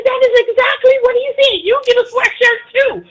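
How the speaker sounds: high, loud giveaway shout; Oprah Winfrey. Retold or imitated. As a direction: shout out prizes to the crowd, fast at first. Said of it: "you should be Oprah Winfrey".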